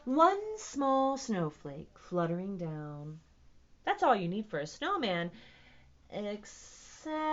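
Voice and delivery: slow, expressive picture-book reading; animated read-aloud, building with excitement; story narration. A narrator's reading. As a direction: stretch the words for young listeners. Reads picture book aloud to children.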